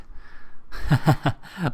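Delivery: put-on a spiteful cackle at the boy's plea; cruel laugh. A narrator's reading. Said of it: "laughed Missus Squeers".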